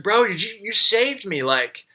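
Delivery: high, stunned stammer; realizing the dealer saved his life, pitched high and stunned; shocked. Retold or imitated. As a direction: sputter it out in disbelief. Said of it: "I was, like, so shocked".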